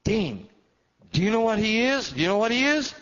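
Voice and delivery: high, loud, urgent; excited call. Retold or imitated. Father urging his son, high and loud. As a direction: press him for the answer. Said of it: "my dad said".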